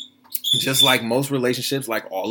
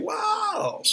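much higher, cracked cry of disbelief; stunned wow; 4 dB quieter than usual. Real, not acted. Host reacting to Nicole Murphy cheating gossip, stunned high wow. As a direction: let the wow break in shock.

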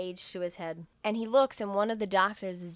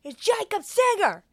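high, drawn-out yell; acted-out bellowed search; screamed demand; 12 semitones higher, 7 dB louder than usual. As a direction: holler it slowly, demanding the man. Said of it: "And he's screaming".

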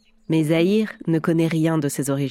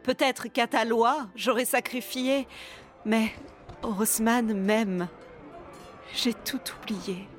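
soft, higher, intimate tragic heroine; right in your ear; 4 semitones higher, 7 dB quieter than usual. Acted. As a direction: murmur the verses close to the mic, lovingly torn. Actress recites Zaïre's verses.

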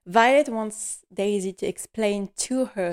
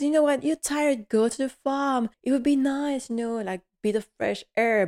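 higher, coaxing cook voice; lightly voiced cook; 6 semitones higher than usual. Cook urges maid to rest at farm, coaxing. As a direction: coax her out to the farm. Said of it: "Miss Patmore says".

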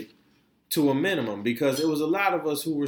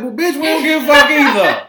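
high, loud retort; retorting sharply; 9 semitones higher, 9 dB louder than usual. Retold or imitated. Friend retells snapping at a coughing lady, high and loud. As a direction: snap back at her, high and loud. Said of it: "I said".

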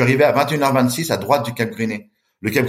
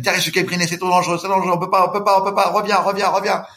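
frightened pleading; 7 semitones higher than usual. Retold or imitated. She begs him to come back.

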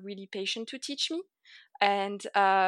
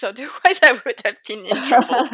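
laughing; 3 semitones higher, 11 dB louder than usual. Real, not acted. Laughing at her own story.

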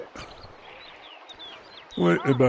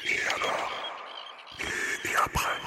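hushed airy whisper; ASMR whisper; 10 dB quieter than usual. Acted. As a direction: whisper close to the mic. [name] now whispers too.

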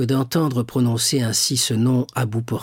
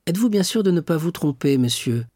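feigned casual; 7 semitones higher, faster than usual. A narrator's reading. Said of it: "de l'air le plus naturel".

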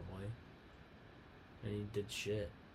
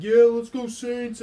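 high, loud slow cheer; mock fan cheer; 10 semitones higher, 14 dB louder, slower than usual. Imitating Packers fans cheering at the TV, loud cheer.

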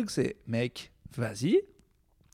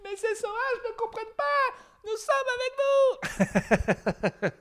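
much higher, crying-out complaint; high mock wail; whining offended liberal. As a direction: whine about the savages in shock. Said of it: "et il fait".

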